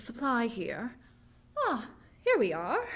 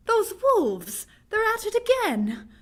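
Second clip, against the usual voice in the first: higher and louder, pointing out the noise; alarmed; 6 semitones higher, 5 dB louder than usual. Acted. Woman points out the wolves to the doctor, alarmed. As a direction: urge him to listen, rattled. Wolves howling outside again.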